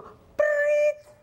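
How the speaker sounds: shrill, high imitated police whistle; piercing mouth-made whistle trill; imitated whistle blast. Traffic inspector stops a car at the red light, imitated whistle blast.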